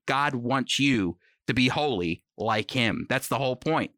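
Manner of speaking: ordinary talk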